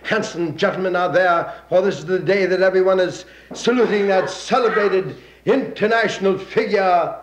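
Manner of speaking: breathless radio play-by-play of a parade; parade radio announcer. Acted. Announcer describing the parade to radio listeners, eager broadcast voice. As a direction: sell the parade to the listeners at home.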